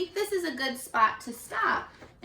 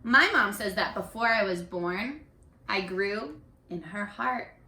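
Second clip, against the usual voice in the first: gentle storybook reading; reading to children; 4 dB louder than usual. A narrator's reading. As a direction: read each child's page to the class. Teacher reads picture book aloud.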